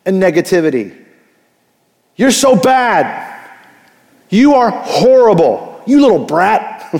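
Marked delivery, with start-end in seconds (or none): 2.2-6.9 slow, higher, scolding with each word leaned on; harsh scolding parent. Retold or imitated. Pastor imitates a parent scolding a child, slow and harsh. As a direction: berate a child like a fed-up parent. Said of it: "hear nothing but harsh correction".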